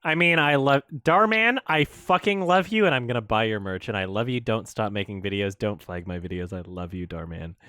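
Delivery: lightly sarcastic fading fan gush; mock adoration, fading to soft; mock fan plea. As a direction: pretend to gush like a fan, trailing off.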